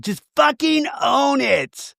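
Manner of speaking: high, very drawn-out mock demand; milk every word like a reality-TV diva; Lisa Rinna. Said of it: "This is very Lisa Rinna code".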